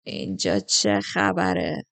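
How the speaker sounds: very low, slow tipsy soldier; heavy-tongued, dragging the words in a deep voice; thick-tongued drunk. A narrator's reading. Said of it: "با زبان سنگین گفت".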